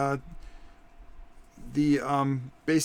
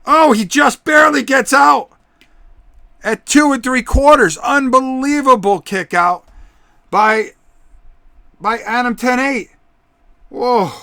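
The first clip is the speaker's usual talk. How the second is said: louder, higher ringside shouting; wrestling commentator; 9 semitones higher, 12 dB louder than usual. Acted. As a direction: yell the count like a hyped announcer. Near fall called at full volume.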